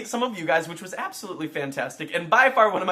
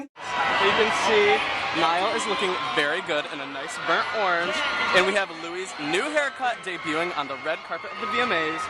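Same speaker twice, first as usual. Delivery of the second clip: excited red carpet commentary. Real, not acted. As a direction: call it like a red carpet host. Narrating red carpet arrivals live.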